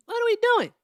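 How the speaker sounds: high, loud, fed-up cry; exasperated shout. Real, not acted. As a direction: throw it up in disbelief. Fed up with caller's rant.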